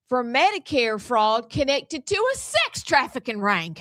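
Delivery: a lightly put-on Southern accent; mild Southern newsreader accent. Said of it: "in my real Southern accent".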